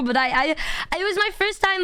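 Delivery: normal talking voice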